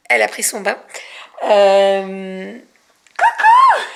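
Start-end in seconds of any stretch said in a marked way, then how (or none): 3.2-3.8 high sing-song coucou. Real, not acted. Greeting the baby.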